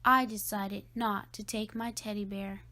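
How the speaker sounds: plain reading voice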